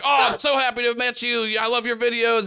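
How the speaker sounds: mildly hammed-up fan gushing. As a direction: gush a little at meeting an idol.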